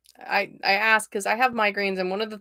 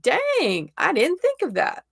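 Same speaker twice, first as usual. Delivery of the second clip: higher-pitched, mock-amazed reaction; impressed surprise; 8 semitones higher, slower than usual. People reacting to your out of the box ideas, impressed surprise. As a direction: play someone struck by a clever idea. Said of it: "people are like".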